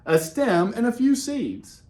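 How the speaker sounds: usual speaking voice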